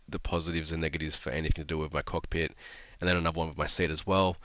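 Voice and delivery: usual speaking voice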